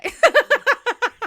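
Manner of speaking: loud, high burst of laughter; big knowing cackle of amusement; real laugh. Real, not acted. Laughing at men like this today.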